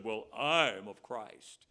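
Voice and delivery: lightly mock a proud churchgoer; smug retort. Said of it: "They said".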